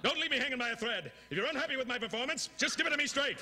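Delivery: high, fast, overwrought pleading; frantic hammy actor. Acted. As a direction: beg like a fading B-movie star. Repeats the plea more frantic.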